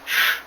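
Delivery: loud sharp shush; sharp hush. Acted. Narrator hushing the loud stranger, sharp loud shush. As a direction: cut him off with a hiss of shh. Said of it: "I hushed him sharply".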